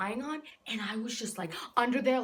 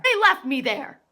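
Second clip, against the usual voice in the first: very loud, higher-pitched indignation; outraged repeat; 5 semitones higher, 11 dB louder than usual. Acted. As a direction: shout the grievance in disbelief.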